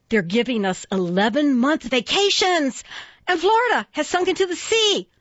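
high, excited announcing of outlandish news; excited tall tales. Acted. Prankster feeds someone wild April Fool news, excited announcing. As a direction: pitch up, gleefully breaking shocking news.

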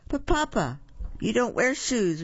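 high-pitched little wolf voice; child character's lifted, high reply; little wolf protesting. A narrator's reading. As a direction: pipe up high to correct Papa. Young wolf answers his papa.

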